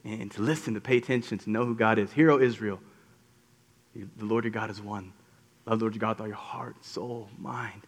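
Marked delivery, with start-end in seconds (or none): 2.1-7.8 quiet, fading recital of the Shema; hushed recitation. Acted. Preacher reciting the Shema softly to the church. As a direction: let it drop to near a murmur.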